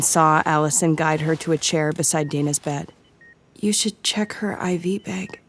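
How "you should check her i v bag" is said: soft unvoiced murmur, slow. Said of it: "she murmured".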